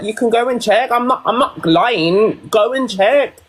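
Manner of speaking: high, insistent protest. Retold or imitated. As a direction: insist you're telling the truth. Insisting to clinic staff.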